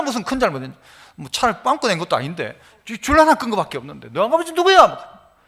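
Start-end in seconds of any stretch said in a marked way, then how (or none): 4.1-4.9 higher-pitched mock-angry demand; retold scolding. Driver berating a child over a scratched line, retold scolding. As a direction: act out a driver telling off a child. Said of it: "막 야단을 치니까".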